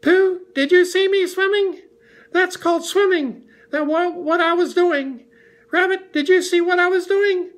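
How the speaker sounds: very high, loud little squeal; excited squeak. A narrator's reading. Roo wants Kanga to admire the swim, squeaking excitedly. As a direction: squeak it out, bursting to be noticed. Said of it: "squeaked Roo excitedly".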